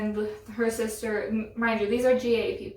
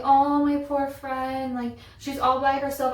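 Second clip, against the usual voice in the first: higher, drawn-out mock sympathy; mock pleading; 4 semitones higher than usual. Imitating a pushy girl fretting over her friend, mock pleading. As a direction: stretch it out slowly, imitating a pleading concertgoer.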